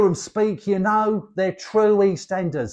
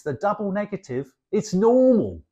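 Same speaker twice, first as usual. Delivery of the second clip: ordinary delivery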